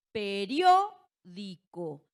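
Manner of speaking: "periódico" very slowly, loud long RIÓ, then di-co; stressed syllable shown. Shown as a demonstration. Teacher presenting the example word, "periódico" slow with a loud stressed RIÓ. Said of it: "dónde recae la sílaba tónica".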